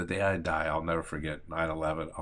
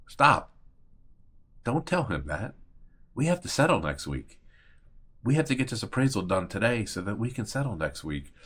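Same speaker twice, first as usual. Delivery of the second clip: imitating insistent agent; 3 semitones higher, 4 dB louder than usual. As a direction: cut them off firmly and insist, as a retold quote. Acts agent cutting her off.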